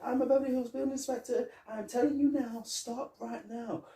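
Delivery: high, fast put-on official voice; mock inspector barking orders; bossy inspector. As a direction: order the workers around in a squeaky fake authority. Imitating Foley as inspector.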